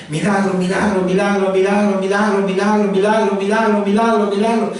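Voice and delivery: insistent nonstop chant on a level pitch; trance-like prayer. Acted. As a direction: chant it over and over without a break. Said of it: "entra como en un trance". (rezo insistente, como en trance)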